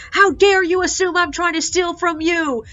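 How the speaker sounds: hammed-up cutting-in outrage; retold angry outburst. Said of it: "she's cutting over me".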